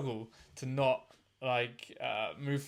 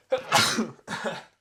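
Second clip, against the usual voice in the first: a sudden real sneeze; loud burst of a sneeze; sneezing; 6 semitones higher, 10 dB louder than usual. Real, not acted. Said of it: "sorry, I'm gonna sneeze".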